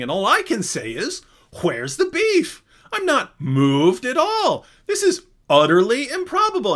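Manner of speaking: hammed-up Craig, far up in pitch; Craig impression. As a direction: drag out the cow pun like a pompous debater. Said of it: "he was going".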